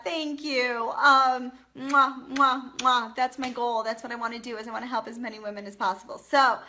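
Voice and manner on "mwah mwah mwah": loud smacking air kisses; blown kisses. Real, not acted. Thanking a viewer on the live, loud blown kisses. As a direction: blow kisses at the camera.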